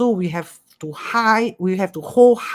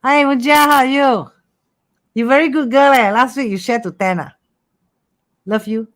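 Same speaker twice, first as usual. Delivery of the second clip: high, loud, bright hello to a viewer; cheerful greeting; 6 semitones higher, 6 dB louder than usual. Real, not acted. Host greeting a live viewer Mujia, gushing and upbeat. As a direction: greet a fan with delight.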